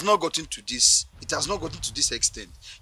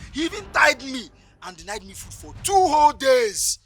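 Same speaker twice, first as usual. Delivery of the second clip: rising upset protest; 10 semitones higher, 7 dB louder than usual. Acted. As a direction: voice climbs higher and louder in protest.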